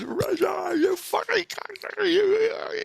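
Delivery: very high, strained shouting; high-pitched ranting impression; mock angry name-calling. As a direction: act out a furious housemate hurling insults. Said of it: "he's calling me out these names".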